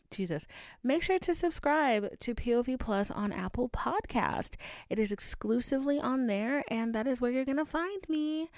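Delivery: bright, brisk outro promo; podcast sign-off promo read. Acted. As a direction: sell the subscription upbeat.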